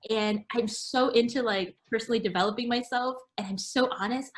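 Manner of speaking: usual speaking voice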